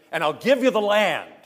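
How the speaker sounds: high, loud divine promise; proclaiming voice. Acted. God promising Abraham the land, loud and high. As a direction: declare it grandly as God.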